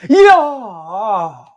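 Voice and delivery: high, loud cheer of joy; goal celebration. Real, not acted. Fan celebrating the goal on stream, loud high cheer. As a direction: let it burst out as they score.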